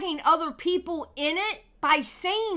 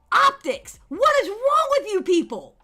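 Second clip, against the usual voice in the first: exasperated outburst; 8 semitones higher, 4 dB louder than usual. Real, not acted. Exasperated rant at Mister Beast.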